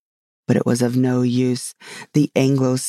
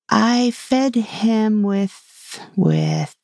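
slow, higher, searching for a word; hesitating; 7 semitones higher, much slower than usual. A narrator's reading. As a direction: stretch each word while thinking what comes next. Alice hesitates in a word game.

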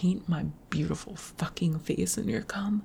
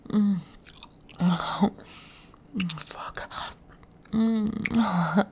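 moaning; 4 dB louder, much slower than usual. Acted. Climax moans in roleplay.

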